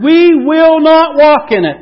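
high, loud, drawn-out defiance; defiant refusal. Acted. Israel refuses God's way at the intersection, loud defiant refusal. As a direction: refuse loudly, slowly, word by word.

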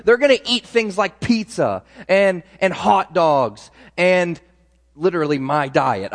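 ordinary talk

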